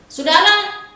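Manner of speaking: high, fast, pressing preaching; urgent fast preaching. Acted. Pastor scolding reluctant worshippers, urgent fast preaching. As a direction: drive it home quickly and loudly.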